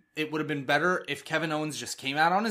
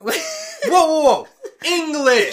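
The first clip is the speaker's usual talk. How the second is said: high, very loud baffled shout; hammed-up exasperated yell; panicked protest; 13 semitones higher, 10 dB louder, slower than usual. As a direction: shout it like you understand nothing around you. Acting Miz confused amid French yelling.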